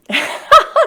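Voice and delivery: high, loud laugh of release; a real, high laugh that breaks the tension; emotional laugh. Real, not acted. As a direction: laugh through the tears. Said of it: "It's an emotional moment".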